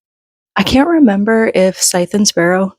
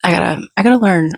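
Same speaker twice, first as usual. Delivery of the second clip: usual speaking voice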